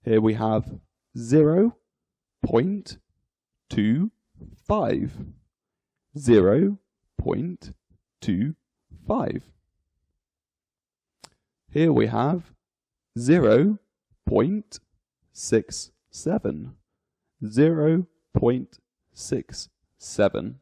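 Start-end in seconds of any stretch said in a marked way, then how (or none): 1.2-9.4 each word set apart by pauses; digit by digit. Shown as a demonstration. Teacher reading a decimal for learners, digit by digit. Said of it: "each digit is read individually". 13.2-20.6 each word set apart by pauses; digit by digit. Shown as a demonstration. Teacher reading a decimal for learners, digit by digit. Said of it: "each digit is read individually".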